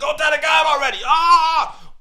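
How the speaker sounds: put-on high, shouted kid's thrill; over-exaggerated excitement. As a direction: yell it like a kid hooking a fish. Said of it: "gotta be exciting, over-exaggerated".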